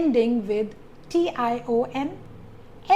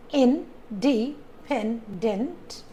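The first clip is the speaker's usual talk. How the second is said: Independent, very slowly split into four beats; syllable by syllable; much slower than usual. Shown as a demonstration. Teacher counts four syllables, saying Independent in separate beats. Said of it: "independent has four syllables".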